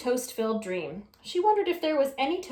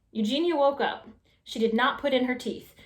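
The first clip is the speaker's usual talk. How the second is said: plain reading voice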